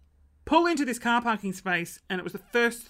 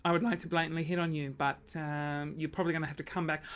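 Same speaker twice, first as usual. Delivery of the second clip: softer, fast private thought; softer, quicker inner monologue; 4 semitones lower, 7 dB quieter, faster than usual. Pretend. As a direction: rattle it off under her breath. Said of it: "In my mind, I was like".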